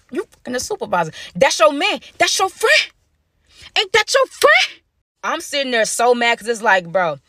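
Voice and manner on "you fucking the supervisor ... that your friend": mocking accusation. Mocking Diana about supervisor.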